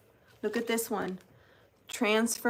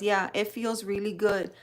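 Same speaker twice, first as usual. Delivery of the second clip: ordinary talk, nothing marked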